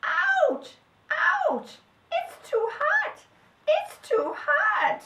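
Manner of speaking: very high, loud squeal of pain; tiny creature yelping from the heat; squeaky little voice, crying out. A narrator's reading. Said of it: "cried the little voice again".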